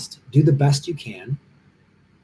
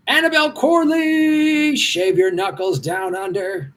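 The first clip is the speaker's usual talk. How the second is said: high, slow, stretched-out Aussie call; hammed-up bad Australian drawl, pitched up; bad Australian accent; 5 semitones higher, slower than usual. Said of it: "Horrible accent".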